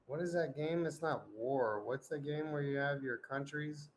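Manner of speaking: ordinary delivery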